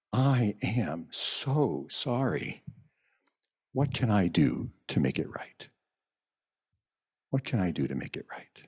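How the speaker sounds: mean every word, gently; sincere apology. Pretend. Someone who wronged you apologising, soft and sincere.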